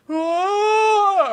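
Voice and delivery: a mock howling cry, drawn out; imitating a ghostly howl. Retelling the ghost's howl, a mock eerie cry. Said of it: "does the voice in the show".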